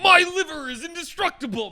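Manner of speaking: high, loud, hammed-up boast; mock party boast. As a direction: brag like an invincible party animal. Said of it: "I'm not like".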